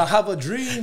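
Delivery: high, loud, hammed-up orator; mock grand speech. Joking to friends about believing, a mock orator's boom. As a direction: belt it like a famous speech, tongue in cheek.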